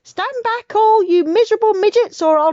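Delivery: put-on towering old hag shouting down at everyone. A narrator's reading. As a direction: bellow it like a wild giant granny. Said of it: "jumping over trees and sheds and shouting".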